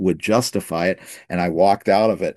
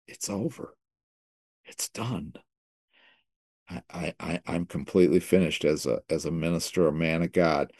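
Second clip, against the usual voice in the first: hushed, broken murmur; quiet despair; 5 semitones lower, 8 dB quieter than usual. Retold or imitated. To the Lord after failing, hushed despair. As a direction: mutters his defeat, barely audible. Despairing quote of himself at his lowest.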